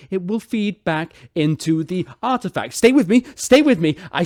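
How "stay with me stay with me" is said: louder, higher insistence; captain pressing a doubtful crowd; urgent plea. Acted. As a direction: hold the crowd's attention.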